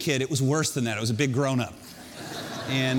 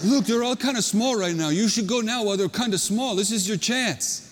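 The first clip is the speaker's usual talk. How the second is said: put-on Hawaiian accent; 3 semitones higher than usual. Counselor telling a worried kid to go now, Hawaiian accent. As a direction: coax him in a Hawaiian accent. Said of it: "in this Hawaiian accent".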